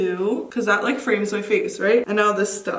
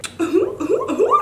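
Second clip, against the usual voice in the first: high, rising "hoo hoo hoo"; giddy whoops; 12 semitones higher, 3 dB louder than usual. Real, not acted. Cutter relieved the trim works, high giddy whoops. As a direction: whoop giddily with a laugh in it.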